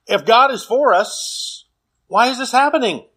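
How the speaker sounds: mildly dramatized anguished appeal; crying out. Acted. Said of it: "we cry out".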